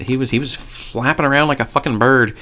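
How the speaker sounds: ordinary talk, nothing marked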